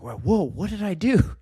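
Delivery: high-pitched, startled self-questioning; retold alarm. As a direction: act out being stunned at yourself. Retelling alarm at his own outburst.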